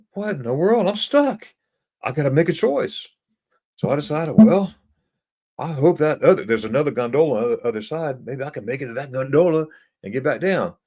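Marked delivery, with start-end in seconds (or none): 0.1-3.1 much higher, startled outcry; alarmed disbelief. Retold or imitated. Realizes he is stuck. 4.3-10.8 anxious hope. Retold or imitated. Retells hopeful decision.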